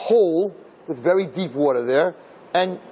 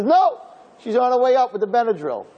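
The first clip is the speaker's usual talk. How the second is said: lightly mimicked friend, higher; retold surprised answer; 8 semitones higher than usual. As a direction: blurt the news in a high voice.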